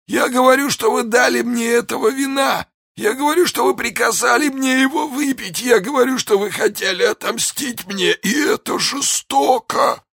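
very high, strained, wailing shout; anguished accusation. A narrator's reading. Poisoned soldier accusing D'Artagnan of revenge, anguished wail. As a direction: wail each charge in agony, dragging out the last.

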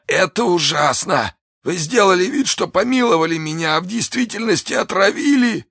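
very high, strained, anguished voice; dying man's outcry. A narrator's reading. Poisoned soldier accusing D'Artagnan, anguished outcry. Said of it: "воскликнул он, увидев Д'Артаньяна".

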